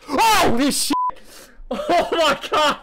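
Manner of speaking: very high, loud, shrieking panic; startled screaming. Real, not acted. Streamer startled in the restroom, screaming. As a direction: shriek and laugh in shock.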